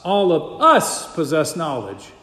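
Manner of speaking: "us" leaned on hard in "All of us"; stress on US. Shown as a demonstration. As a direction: punch the word "us", the rest falling away. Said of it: "putting the emphasis on the us".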